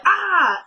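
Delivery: high, drawn-out "ah"; imitated pained cry. Attacker reacting as she fights back, imitated cry.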